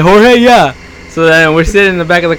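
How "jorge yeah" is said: Jorge said hor-heh, a bit higher and louder; Spanish name call. Retold or imitated. Coworker confirms the name: Jorge said the Spanish way.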